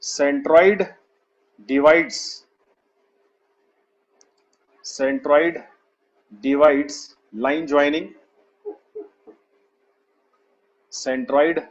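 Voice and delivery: slow, word-by-word dictation; drawn-out words for students writing along. Real, not acted. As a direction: spell out the note slowly, pausing long between phrases. Teacher repeats dictation for note-taking.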